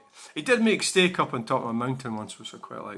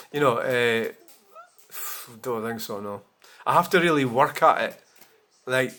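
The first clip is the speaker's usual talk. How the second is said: ordinary talk, nothing marked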